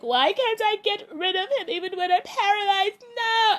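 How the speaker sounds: whining high wail, no at the top; mock despair. Heroine wails to herself about the boss, high and despairing. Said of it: "And she's like".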